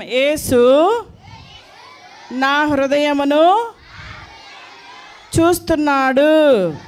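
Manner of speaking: slow, loud, drawn-out recital; call-and-repeat line. Shown as a demonstration. Teacher leads children in saying a line together, slowly and loudly.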